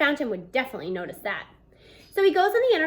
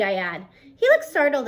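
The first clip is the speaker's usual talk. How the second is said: ordinary delivery, nothing marked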